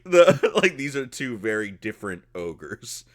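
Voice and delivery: normal talking voice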